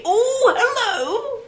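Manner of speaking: surprised. Pretend. Sudden mock greeting.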